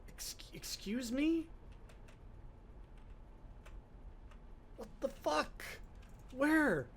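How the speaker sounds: high-pitched, drawn-out incredulous questions; slow, high-pitched baffled outburst; exasperated disbelief. Real, not acted. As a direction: squawk it in rising frustration at being lost. Lost, cannot find the stairs.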